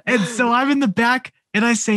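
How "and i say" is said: ordinary delivery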